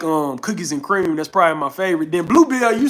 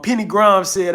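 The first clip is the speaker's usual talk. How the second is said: usual speaking voice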